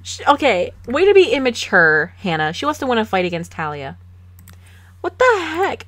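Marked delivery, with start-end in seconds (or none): 5.0-5.9 much higher, louder disbelief; can't believe what she's seeing; incredulous. Real, not acted. Hannah picks a fight.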